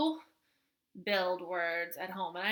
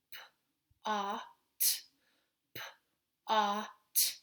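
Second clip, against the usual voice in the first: sounds said separately; 4 dB quieter than usual. Shown as a demonstration. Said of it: "that's good practice to say".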